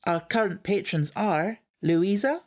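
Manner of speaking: half-hearted Scottish lilt for the list; weak Scottish accent. Acted. Reading the patron list to listeners, mild Scottish accent. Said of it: "It's not a very good accent".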